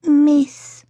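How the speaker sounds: put-on high small-child voice; childlike high murmur; little boy's quiet, complex tone. A narrator's reading. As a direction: echo it thoughtfully as a toddler. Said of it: "said Toby in a quiet, complex tone".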